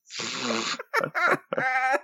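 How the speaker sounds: high wheezing laugh; a real high laugh bursting out; laughing hard. Real, not acted. Laughing at the quote.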